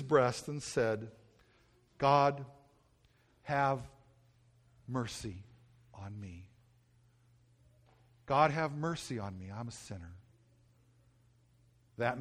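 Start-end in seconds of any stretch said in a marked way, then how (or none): 2.0-10.1 humble plea. Acted. Tax collector beats his breast.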